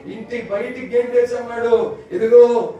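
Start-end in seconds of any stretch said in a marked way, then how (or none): 2.1-2.7 loud, sharp call of an angry father; stern call. Retold or imitated. Father turning his son out of the house, loud. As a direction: bark it like a father losing patience.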